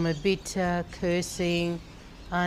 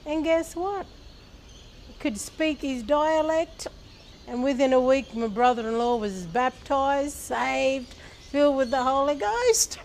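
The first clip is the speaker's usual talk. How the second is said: much higher, thrilled telling; excited; 7 semitones higher than usual. Real, not acted. Telling the church how the brother-in-law was saved, thrilled and excited. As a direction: build the excitement of the payoff. Climax of conversion story.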